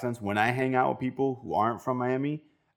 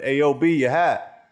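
loud, raised New York street call; New York stranger; 5 semitones higher, 6 dB louder, slower than usual. Retold or imitated. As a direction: call it out like a helpful New Yorker. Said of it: "some random guy picked it up and said".

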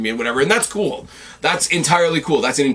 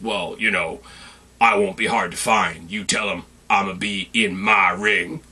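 imitating the champion; 4 semitones lower, 3 dB quieter than usual. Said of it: "And he says".

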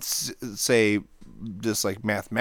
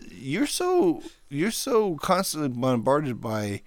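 ordinary talk, nothing marked

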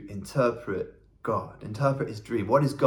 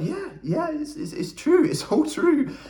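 very high-pitched, squeaky reply; flustered agreement; slower than usual. Retold or imitated. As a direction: act out a startled, squeaky yes. Acts own flustered reply to pastor.

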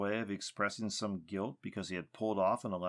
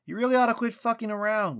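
loud, high furious yell; angry yell; 12 semitones higher, 9 dB louder, faster than usual. A narrator's reading. Lucas yelling at the silent tree, furious. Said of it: "Lucas yelled".

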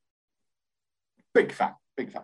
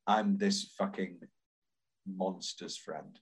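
soft, slow and low, giving up; resigned, slow, softer acceptance; defeated acceptance; 7 semitones lower, 8 dB quieter, slower than usual. Retold or imitated. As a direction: let it sink, slow and quiet. Quoting Burt's acceptance.

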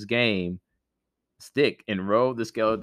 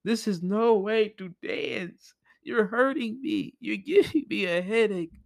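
whiny skull voice; 12 semitones higher than usual. Acted. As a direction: whine it in a high skull voice. Skull complains of pain.

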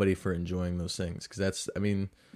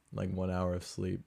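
usual speaking voice

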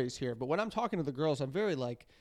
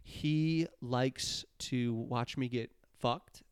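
slow quoted line; 3 semitones lower, 6 dB quieter, much slower than usual. Retold or imitated. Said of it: "And she goes".

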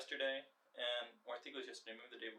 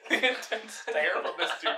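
laughing; 8 semitones higher, 14 dB louder than usual. Real, not acted. Laughing at the outro.

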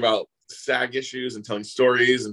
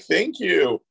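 high, lilting mock thank-you; singsong gracious reply; 8 semitones higher, 3 dB louder than usual. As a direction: imitate a flattered woman, sweetly pitched up.

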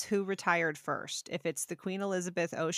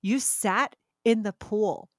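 louder, slow and emphatic; indignant accusation; 2 semitones higher, 7 dB louder, slower than usual. Real, not acted. Sister reliving the Barbie yacht offence, indignant. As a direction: land each word like a charge.

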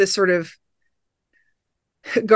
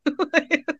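high giggle; 9 semitones higher, 5 dB quieter than usual. Real, not acted. Laugh alone.

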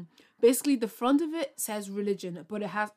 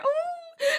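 a very high, delighted squeal; excited ooh. Real, not acted. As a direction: squeal at your own big news. After announcing ambassadorship.

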